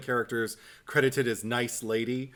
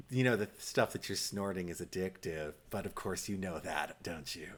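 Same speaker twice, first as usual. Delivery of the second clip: low, hushed busybody impression; obnoxious woman; 5 semitones lower, 8 dB quieter than usual. Imitating a nosy plane seatmate to a sick passenger, obnoxious. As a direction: drop low and soft, needling like an intrusive stranger. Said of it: "this kind of obnoxious woman".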